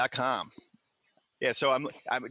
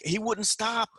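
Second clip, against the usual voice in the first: mock whine; 11 semitones higher, much slower than usual. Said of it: "and he's like".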